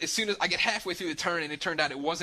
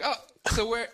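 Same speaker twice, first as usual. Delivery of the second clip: mock puzzled; 8 semitones higher than usual. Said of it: "I'm like".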